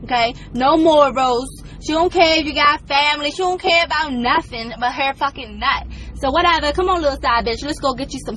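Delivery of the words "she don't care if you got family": usual speaking voice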